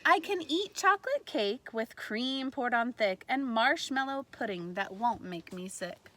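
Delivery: usual speaking voice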